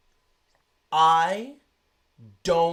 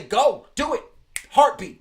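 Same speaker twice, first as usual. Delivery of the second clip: louder, higher imagined shout to the team; pretend eager yell; 5 semitones higher, 7 dB louder than usual. Telling the Nets to take the trade, excited shout. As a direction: urge them on with a quick excited call. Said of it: "And I would say".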